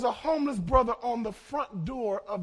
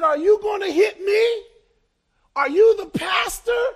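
startled man; 9 semitones higher, 8 dB louder than usual. Retold or imitated. Homeless man startled, recoiling.

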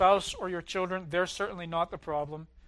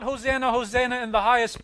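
quick, louder hosanna cry; crowd's joyful shout; 5 semitones higher, 9 dB louder, faster than usual. Retold or imitated. Preacher voicing the joyful crowd: a quick, loud hosanna. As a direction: echo the crowd's glad shout, fast. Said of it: "the crowds shouting something".

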